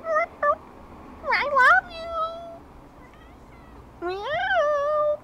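very high squeaky I love you and meow; cat voice. Shown as a demonstration. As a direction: mimic the mother cat's call. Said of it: "very vocal".

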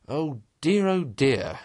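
exasperated lament; despairing. A narrator's reading. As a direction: wail in despair at someone's obtuseness. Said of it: "cried the rat in despair at his obtuseness".

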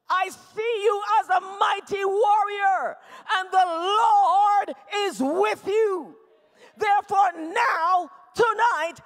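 loud, slow proclamation with long words; full-force preaching. Acted. God commissioning a fearful warrior, proclaimed at full force. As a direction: declare it like a call to battle.

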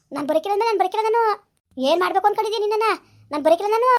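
fast, loud, furious outburst; angry shout. Acted. Daughter-in-law shouting at her mother-in-law, fast and furious. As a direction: snap back hard and fast.

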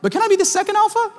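put-on very high, quick child voice; pleading little boy. Five-year-old asking his dad for second place, high pleading voice. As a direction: imitate a small kid's hopeful plea.

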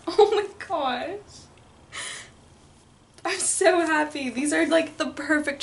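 high, tearful and slow, almost sobbing; overwhelmed, thanking through happy tears. Real, not acted. Thanking pen pal Carolyn for the books, in happy tears. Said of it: "I just start crying".

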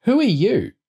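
put-on very high, indignant child's voice; defiant kid. A narrator's reading. Young boy answering back to a bossy girl, defiant. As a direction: challenge it like a cheeky small boy. Boy defies the girl's order.

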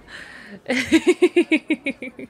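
big high laugh at her own joke; loud laugh. Real, not acted. Laughing at her own stone-wall bluff to her partner. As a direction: crack up loudly.

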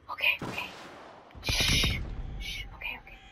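whisper. Real, not acted. Hiding from monster, hushing.

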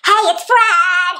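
over-the-top cartoon kid greeting; Fred voice. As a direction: shout it like a hyper kid host. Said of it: "Maybe something like this".

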